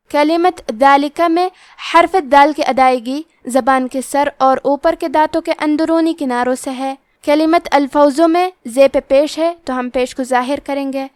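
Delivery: usual speaking voice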